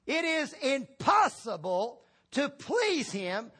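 high, slow, emphatic pulpit delivery; impassioned preaching. Real, not acted. Preacher explaining that faith is essential, slow emphatic preaching. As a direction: hammer each phrase with spaces between.

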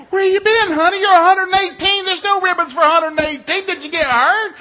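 high, loud incredulous grilling; hammed-up dad outrage at full volume; exasperated yelling. Dad questioning his daughter at the finish, exasperated.

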